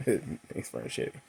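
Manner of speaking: soft whispered dig; whispered aside. Real, not acted. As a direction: whisper it like gossip. Gossipy aside about Mark.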